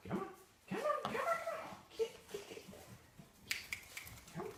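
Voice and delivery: very high, soft coaxing call; high-pitched dog coaxing. Real, not acted. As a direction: coax the dog in a squeaky voice.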